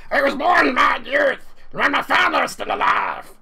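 high, loud, shouted creature voice; cockroach monster voice. Acted. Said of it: "harder to understand [name] than it is to understand German".

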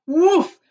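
loud, high burst of "woof"; whoosh sound effect. A narrator's reading. Narrator voices boy bursting free, loud whoosh. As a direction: imitate a sudden whoosh.

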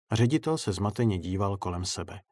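ordinary delivery, nothing marked

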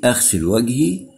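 ordinary talk

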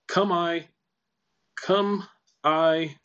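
"Come" pitched high, stepping down on "I"; dropping on I. Shown as a demonstration. Teacher working out the line's pitch curve, dropping on I. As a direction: start high on "Come" and drop at "I". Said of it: "it's gonna go down at I".

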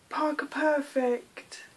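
usual speaking voice

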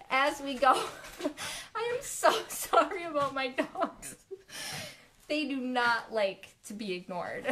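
ordinary talk

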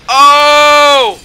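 loud, high drawn-out "oh"; amazed whoop. Real, not acted. Gamer showing viewers his great move, amazed loud whoop. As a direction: cry out in astonished triumph.

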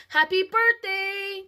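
high, loud, slow sniffly birthday wish; a mild hint of a tearful toddler; through sniffles. A narrator's reading. Cassie sniffles her wish to Aunt Denise, high and slow. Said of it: "Cassie said through sniffles".

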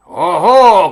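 huge booming exclamation; bear cry. A narrator's reading. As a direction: shout it out in bearish outrage. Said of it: "крикнув він".

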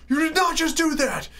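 high, furious mock yell at the driver; angry cop. Cop yelling at John through the rolled-down window, high angry shout. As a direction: bark it like an enraged traffic cop. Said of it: "But he was so angry".

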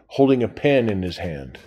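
plain reading voice

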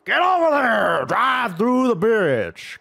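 high shout with stretched-out words; yelled command. Real, not acted. Gamer ordering cars across the bridge, yelling. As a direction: holler at the drivers, dragging it out.

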